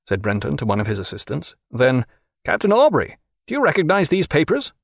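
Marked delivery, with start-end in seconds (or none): 2.4-4.7 high-pitched, officious questioning; agitated official interrogator. A narrator's reading. Brenton puts the charge to Jack, officious and high. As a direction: read the charge in a high, insistent voice.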